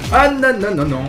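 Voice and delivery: high, rapid string of no's, falling off; alarmed protest. Real, not acted. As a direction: blurt it out mid-fight, high-pitched. Alarmed while playing the fight. (protestation affolée, voix aiguë)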